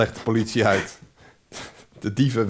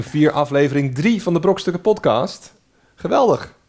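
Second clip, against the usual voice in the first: excited; 7 semitones higher, 4 dB louder than usual. Acted. Hosting the episode intro.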